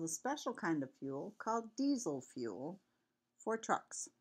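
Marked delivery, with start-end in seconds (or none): none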